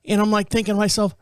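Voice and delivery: ordinary talk, nothing marked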